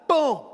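high, loud "boom!"; explosive vocal sound effect; imitated impact sound. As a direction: burst out the sound of a blow landing. Imitates the slap landing.

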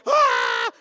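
very high, loud mock gasp; surfacing gasp. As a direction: imitate bursting up for air. Ira surfaces from the water.

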